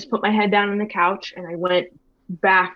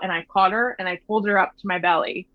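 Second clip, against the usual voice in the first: ordinary talk, nothing marked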